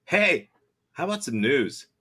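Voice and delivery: ordinary talk, nothing marked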